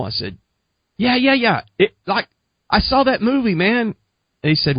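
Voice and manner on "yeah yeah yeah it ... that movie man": high-pitched, giddy dude voice; naive eagerness. Retold or imitated. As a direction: play your clueless younger self, pitched way up. Acting out naive, eager younger self.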